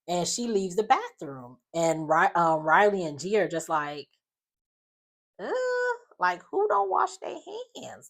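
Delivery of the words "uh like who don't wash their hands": high-pitched mock disbelief. Friends react to her not washing hands, imitated disbelief. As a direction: squeal the question in shock.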